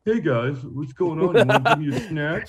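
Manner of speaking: lightly voiced talking dog; a hint of a pet asking for treats. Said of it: "Remy sounds like this".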